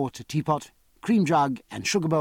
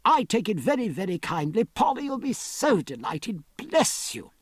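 higher-pitched, delighted thanks; mildly excited gratitude; exclaiming gratefully; 6 semitones higher than usual. A narrator's reading. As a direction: gush with thanks, pitch leaping up. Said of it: "cried Martin".

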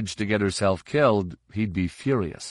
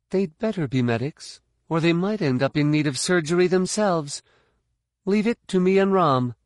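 high-pitched, threatening fighter's voice; tough fighter; 9 semitones higher than usual. Acted. Fighter warning about possible fake medics, tough and high-pitched. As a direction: take charge in a high, tough voice.

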